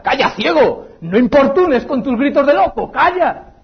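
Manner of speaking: a bystander snaps at the blind beggar; crowd hushing angrily. Acted. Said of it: "le mandan callar".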